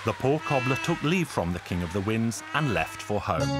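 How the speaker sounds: plain reading voice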